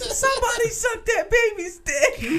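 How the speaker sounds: put-on very high, drawn-out drunken holler; slow mock squealing shout; coked-up club yell. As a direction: howl it slowly like a wasted party guy. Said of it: "he got, like, super coked up when he was drinking".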